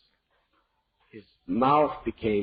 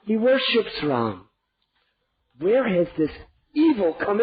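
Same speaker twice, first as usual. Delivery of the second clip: much higher, anguished imploring; desperate pleading; 6 semitones higher, 3 dB louder than usual. Acted. King to queen, desperate imploring. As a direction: plead and rebuke her at once.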